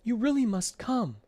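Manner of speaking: high, pleading little voice; urgent plea. A narrator's reading. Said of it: "pleaded Ed".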